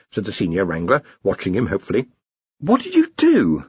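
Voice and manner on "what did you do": high, aghast question; horrified. A narrator's reading. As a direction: ask in dismay. Horrified Ponder.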